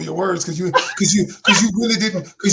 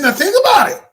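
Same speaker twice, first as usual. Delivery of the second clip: about stressed, high and loud; emphatic retort; 11 semitones higher, 7 dB louder than usual. Retold or imitated. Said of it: "I said".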